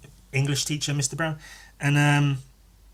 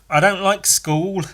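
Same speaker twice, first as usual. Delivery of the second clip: put-on higher, louder, slow little-kid complaint; toddler; 6 semitones higher, 5 dB louder, slower than usual. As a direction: imitate your toddler self protesting to mum. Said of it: "a toddler, three, four years old".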